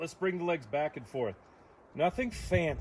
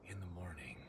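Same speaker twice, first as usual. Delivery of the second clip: whisper; 8 semitones lower, 15 dB quieter, faster than usual. Real, not acted. Aside at end of request.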